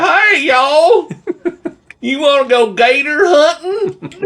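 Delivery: very high, loud cartoon Southern holler; fake Southern accent. Podcaster lampooning a newly arrived coach, over-the-top fake Southern accent. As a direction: squeal it in a mock Southern accent through laughter. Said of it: "This fake Southern accent".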